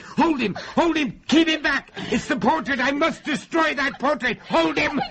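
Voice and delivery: very high, frantic shouted orders; desperate, strained yelling; urgent shout. Acted. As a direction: yell commands in the middle of a struggle. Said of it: "I shouted to his wife".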